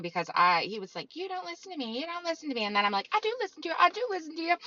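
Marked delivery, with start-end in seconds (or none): 1.2-2.6 mentor nagging. Retold or imitated. Mentor scolding, retold quote. 3.1-4.6 high, pleading mock protest; imitating own high protest; whiny protest. Retold protest to mentor.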